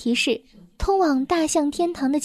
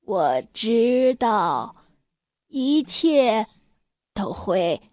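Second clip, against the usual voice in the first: put-on very slow old elephant voice, words drawn out; slow, drawn-out elder's reply; old elephant character; 3 semitones lower, much slower than usual. A narrator's reading. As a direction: answer as an aged elephant, slowly and steadily. Old elephant answers the mouse.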